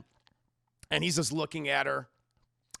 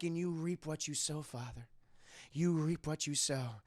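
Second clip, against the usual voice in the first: cold menace; 7 dB quieter than usual. Retold or imitated. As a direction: drop to a quiet, ominous verdict. Son's cold verdict to father.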